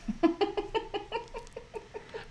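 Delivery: quiet airy giggle; breathy laugh. Real, not acted. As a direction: laugh under your breath. Laughing at himself.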